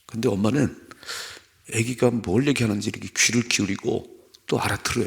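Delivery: ordinary talk, nothing marked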